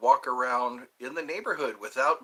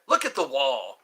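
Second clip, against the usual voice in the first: lightly voiced teacher's command, louder; strict teacher; 5 dB louder than usual. Miss Frosty to misbehaving pupils, mild strict teacher voice. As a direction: order it like a stern teacher. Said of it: "Miss Frosty told us to look at".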